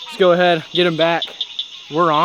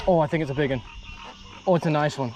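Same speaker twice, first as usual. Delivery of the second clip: ordinary delivery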